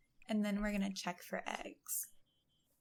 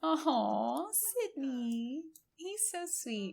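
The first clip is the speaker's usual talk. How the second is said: cooing; 5 semitones higher, much slower than usual. Real, not acted. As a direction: coo at the sweet dog. Cooing over the pet.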